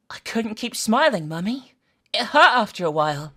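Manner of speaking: lightly voiced Jeff. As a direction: sound sweetly proud of something horrible. Jeff explains his carved smile.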